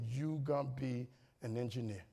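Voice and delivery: low, soft and weighty; hushed retelling of words that stayed; solemn recalled words. Retold or imitated. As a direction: quote it quietly, letting it sink in. Retold words that stuck with him.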